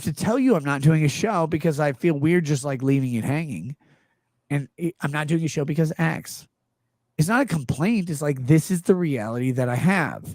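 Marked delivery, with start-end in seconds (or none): none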